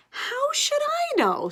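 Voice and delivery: put-on high, slow, whiny boy voice; a stuck kid asking his sister, high and slow; puzzled little boy. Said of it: "says the boy".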